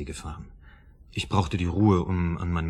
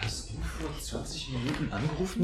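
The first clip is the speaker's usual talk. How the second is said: stunned hush; 7 semitones higher, 9 dB quieter than usual. Acted. Schockierte Frage, leise.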